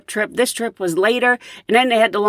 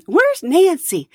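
eager call; 8 semitones higher, slower than usual. Retold or imitated. Next passenger looking for her.

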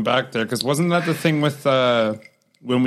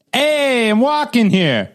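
higher, slow, hammed-up Boston accent; Boston accent attempt; 10 semitones higher, 3 dB louder, much slower than usual. Asked to do a Boston accent, a hammed-up Boston accent. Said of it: "can you try to do a Boston accent for me".

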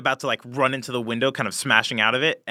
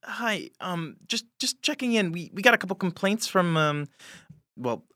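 a timid woman calling through the door; worried neighbor through door; 7 semitones higher than usual. Acted. Hotel worker knocking on the door to check in, high and timid. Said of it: "You hear".

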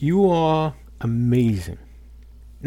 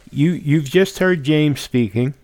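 ordinary delivery

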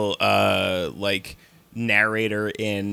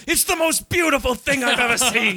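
awed Vegeta; 12 semitones higher than usual. Retold or imitated.

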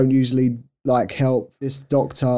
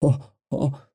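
mock pained breathing; 3 dB quieter than usual. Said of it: "that breathing where you're like".

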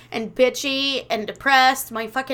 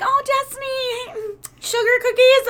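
cheery shop lady; 12 semitones higher than usual. Retold or imitated. Shop lady greeting Destiny at the counter, high sweet sing-song. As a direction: greet a regular customer brightly. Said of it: "The lady's like".

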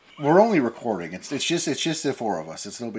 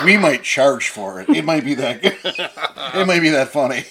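laughing; 5 semitones higher, 9 dB louder than usual. Real, not acted. Teasing about a bad accent.

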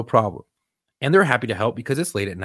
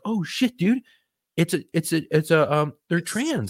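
realization; 6 semitones higher than usual. Retold or imitated. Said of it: "And I was like".

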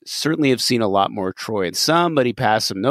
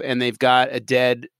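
ordinary delivery

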